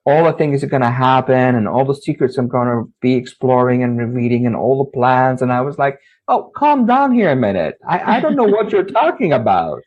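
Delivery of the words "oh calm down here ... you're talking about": higher-pitched, incredulous retelling; playing his teen self as baffled and overwhelmed; exasperated disbelief. Teen boy to the girl claiming the jinn sent her, baffled.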